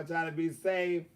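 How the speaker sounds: normal talking voice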